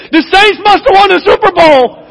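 high, loud gleeful punchline; joyful punchline. Acted. Boudreaux telling the demons why hell froze, gleeful and loud. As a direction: deliver it like an ecstatic Saints fan. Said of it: "He said".

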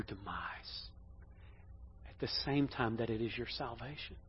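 very soft, low, grave delivery with long pauses; hushed solemn warning. Acted. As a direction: warn softly, nearly whispering at the lowest points. Voices Jesus warning Peter solemnly.

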